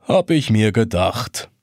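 an echoing voice from inside the glass; reproachful hollow voice. A narrator's reading. The hourglass answers the boy reproachfully, hollow. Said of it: "antwortet vorwurfsvoll eine hohlklingende Stimme".

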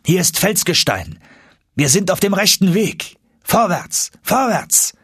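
high, exultant cries; excited exclamation. A narrator's reading. Professor finds rock by lamplight, excited exclamation. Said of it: "als der Professor mit der Lampe an der Wand ausrief".

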